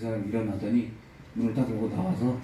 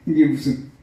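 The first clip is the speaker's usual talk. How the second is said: ordinary talk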